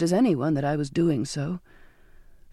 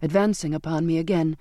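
plain reading voice

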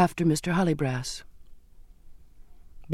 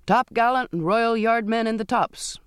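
cried order; 6 semitones higher than usual. A narrator's reading. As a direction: call the men up the masts. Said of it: "he cried".

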